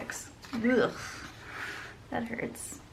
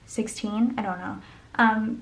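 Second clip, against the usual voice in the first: ordinary talk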